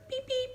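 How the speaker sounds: high squeaky beep beep; car horn beeps. Retold or imitated. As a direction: honk like a tiny car.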